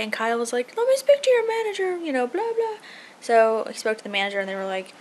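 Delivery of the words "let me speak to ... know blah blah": high, prissy impression of a demand; mock demanding customer. Wife mocking husband asking for the manager, high and prissy. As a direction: pitch it up like a haughty complainer.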